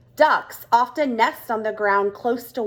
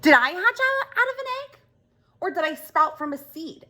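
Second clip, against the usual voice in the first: put-on higher, wide-eyed childlike questioning; a small creature asking in wonder, pitched higher; curious young Oscar; 7 semitones higher, faster than usual. Oscar asks Frog where he came from, higher curious voice.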